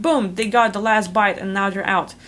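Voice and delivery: normal talking voice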